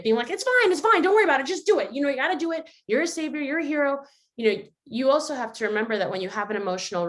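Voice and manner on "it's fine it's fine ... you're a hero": high, insistent pep talk starting loud; overpowering inner voice. Acted. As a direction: push someone on, brushing off every worry. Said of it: "the other voice that maybe is overpowering it".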